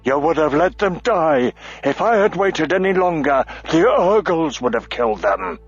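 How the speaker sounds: indignant, firm defense; bristling dwarf. A narrator's reading. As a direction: push back hard. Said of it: "Auric bristled".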